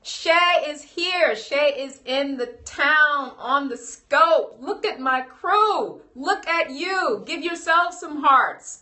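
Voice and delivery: high, loud, slow sing-song welcome; excited greeting. Real, not acted. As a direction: cheer on friends arriving. Greeting friends joining the stream.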